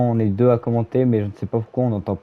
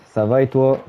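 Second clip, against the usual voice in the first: normal talking voice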